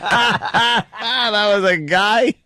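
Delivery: high, slow, laughing question; giggling through a stunned question; laughing disbelief. Real, not acted. Laughing at the crying fan clip.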